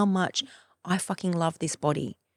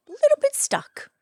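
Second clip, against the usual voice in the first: much higher, squeaky and pouty; squeaky mock voice; 5 dB louder than usual. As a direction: squeak it out like a little kid. Repeating it in a silly voice.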